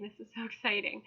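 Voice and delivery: real nerves and thrill spilling out; shaky excitement. Real, not acted. Thrilled creator admits she is shaking with excitement. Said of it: "I'm shaking".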